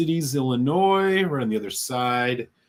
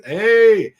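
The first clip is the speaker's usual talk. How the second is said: sudden, much higher and louder hey; surprised hey; 10 semitones higher, 11 dB louder than usual. Real, not acted. Host catching a five dollar super chat, surprised. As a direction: jolt up at the missed super chat.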